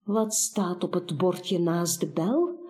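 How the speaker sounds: usual speaking voice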